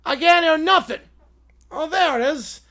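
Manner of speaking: ordinary talk, nothing marked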